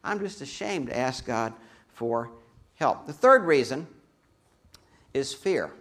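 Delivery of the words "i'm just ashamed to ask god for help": normal talking voice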